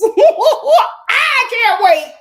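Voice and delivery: squealing through laughter, very drawn out; laughing. Real, not acted. Streamer laughs giddily about her future makeover, squealing laughter. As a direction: laugh it out slow and loud. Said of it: "laughing take before sung take".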